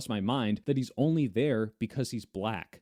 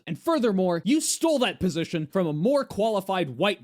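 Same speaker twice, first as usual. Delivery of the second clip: mock angry rant; 5 semitones higher, 5 dB louder than usual. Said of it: "do I say".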